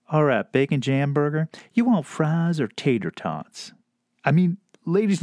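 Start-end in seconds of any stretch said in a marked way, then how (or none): 0.1-3.7 put-on heavenly southern accent. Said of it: "voice was like this heavenly southern breeze".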